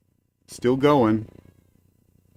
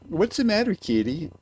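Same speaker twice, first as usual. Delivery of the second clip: high cat-talk; 8 semitones higher than usual. Real, not acted. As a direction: coo up high at the kitty. Talking to the cat.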